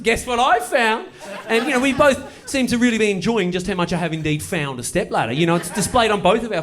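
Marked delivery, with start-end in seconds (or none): 0.0-1.1 slow, higher, louder triumphant announcement; announcing. Retold or imitated. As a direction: proclaim the find slowly with glee. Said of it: "I announce it".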